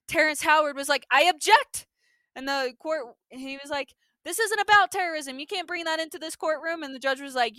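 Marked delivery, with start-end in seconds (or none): 1.1-1.9 loud, high, punched objection; hammed-up forceful interruption; mock courtroom objection. Acting lawyer objecting in court. 4.2-6.8 raised, insistent retold argument; lightly hammed-up courtroom indignation; mock lawyer's protest. Acting lawyer arguing objection.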